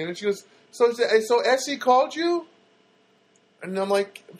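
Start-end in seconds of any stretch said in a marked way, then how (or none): 0.7-2.5 high, slow mock accent; imitated thick-accented phone agent; Luna, thick accent. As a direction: ask it slowly in a thick accent. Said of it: "very thick accent".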